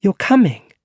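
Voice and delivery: high, loud disbelieving question; can't believe he'll come; surprised. Acted. Girl hearing Peter will come to her play, surprised. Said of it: "She was surprised".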